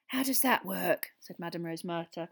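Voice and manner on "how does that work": slow, raised question; mildly eager curiosity; breathless with interest. A narrator's reading. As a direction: ask with keen interest. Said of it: "breathless with interest".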